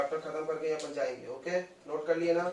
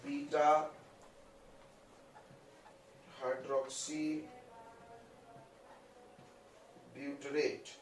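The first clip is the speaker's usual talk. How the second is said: slow dictation; 10 dB quieter, much slower than usual. Shown as a demonstration. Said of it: "इसका full form".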